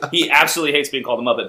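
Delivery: normal talking voice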